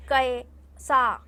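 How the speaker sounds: slow syllable by syllable. Shown as a demonstration. Teacher showing children how to read slowly. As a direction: stretch कैसा slowly, joining the letters. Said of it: "इस तरीके से बोल".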